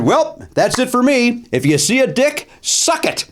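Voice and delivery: high, slow mock showbiz farewell; defiant sign-off. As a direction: sign off to a hostile crowd, drawn out. Retold sign-off to hostile silent crowd.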